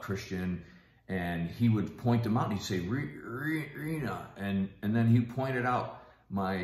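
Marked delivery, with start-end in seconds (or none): 2.8-4.3 higher, stammered imitation of a name; struggling to speak. Dying father points out his late wife, imitated struggling to speak. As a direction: imitate labouring to get the name out. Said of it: "he lost his ability to speak".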